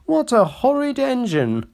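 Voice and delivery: high, indignant cry; prim coaches shocked and offended; outraged coaches. A narrator's reading. Coaches crying out at the horrid new engine. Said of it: "screamed Agnes".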